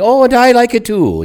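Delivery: high, bright mock-Irish singsong; cheerful mock Irish voice; mock Irish lilt. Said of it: "you know, that kind of".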